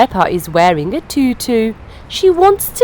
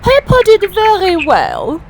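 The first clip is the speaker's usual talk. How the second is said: Madame Gazelle voice; 9 semitones higher, 4 dB louder than usual. Acted. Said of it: "says Madame Gazelle".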